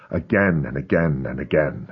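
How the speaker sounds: plain reading voice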